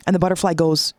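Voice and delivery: usual speaking voice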